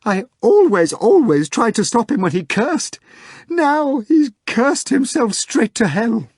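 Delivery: high, loud wail, slowing at the end; screamed. A narrator's reading. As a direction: scream it out in despair. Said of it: "bounced around him and screamed".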